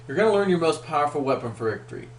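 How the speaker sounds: quick, brisk restatement of the line. Shown as a demonstration. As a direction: rattle the promise off fast. Line repeated, faster take.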